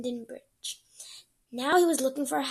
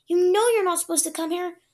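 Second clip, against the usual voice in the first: scolding; 6 semitones higher, 10 dB louder than usual. Acted. Scolding younger brother.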